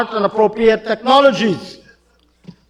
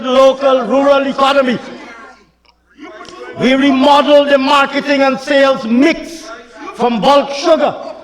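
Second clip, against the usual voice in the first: fiery oratory; 6 semitones higher than usual. Acted. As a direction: rail at the opposition benches.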